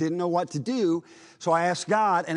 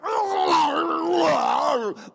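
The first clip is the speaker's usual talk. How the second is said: loud, much higher mock demon cackle; demonic laugh; 12 semitones higher, 4 dB louder than usual. Preacher retelling the demon's change, loud mock demonic laugh. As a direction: shriek a wild demonic laugh. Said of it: "what you might expect from a demon".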